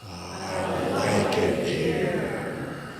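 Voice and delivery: deep and very slow from the belly; slow resonant low voice; low, slow natural voice. Shown as a demonstration. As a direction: let it resonate low from the chest. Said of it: "rather than say".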